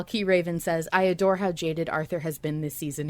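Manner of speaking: ordinary talk, nothing marked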